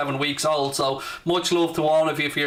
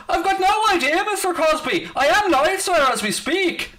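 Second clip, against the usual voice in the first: mock posh voice; 13 semitones higher, 3 dB louder than usual. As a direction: answer the chatter like a fussy butler, pitched way up. Teasing a chatter named Crosby.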